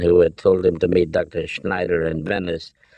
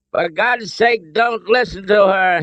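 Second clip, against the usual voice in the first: high, exasperated roar; 12 semitones higher, 4 dB louder than usual. A narrator's reading. Father roars at his son, roaring. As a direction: roar it urgently. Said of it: "Henry roared at his son".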